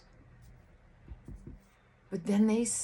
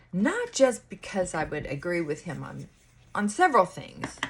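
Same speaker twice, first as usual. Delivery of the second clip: ordinary talk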